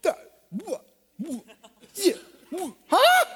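broken syllables ending in a high yelp; sputter, lost for words, then squeak; flustered stammer. Acted.

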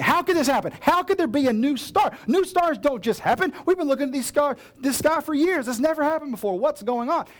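high, loud, worked-up questions; excited discussion. Retold or imitated. Wise men arguing over the new star, excited and high. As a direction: marvel out loud at the impossible star. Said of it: "they start discussing it".

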